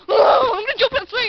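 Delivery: high, loud, hammed-up glee; mock excited squeal. Imitating Lucas jumping on the swing, mock excited. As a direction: shout it like an overexcited kid.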